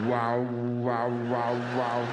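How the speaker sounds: loud, droning wah-wah on one note; vibrating tuning fork. Retold or imitated. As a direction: wobble like a struck tuning fork. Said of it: "vibrating like a tuning fork".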